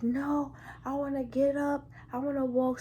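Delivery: high-pitched imitation of an insistent patient. Patient refusing the nurse's plea to sit, high insistent imitation.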